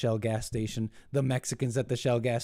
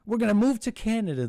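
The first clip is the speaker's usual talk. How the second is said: higher, louder mock whine; higher, louder mocking liberal voice; mock protester; 10 semitones higher, 5 dB louder, faster than usual. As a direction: ham up a dramatic complainer. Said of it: "they're saying".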